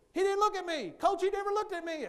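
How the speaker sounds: high, loud mock whine of outrage; indignant complaining girl. Cheerleader complains to coach about shy boy, mock indignant yell. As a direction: shout a tattling complaint way up high. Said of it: "and the girl goes".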